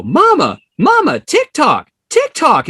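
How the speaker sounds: put-on high, loud child's call; a kid's eager high shout; excited little kid. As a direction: call out like a five-year-old pointing. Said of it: "I hear this little kid".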